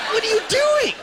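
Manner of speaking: very high, loud squawk of alarm; hammed-up, appalled shriek; shocked disbelief. Nephew watches uncle eat cortisone cream, shocked high-pitched outburst.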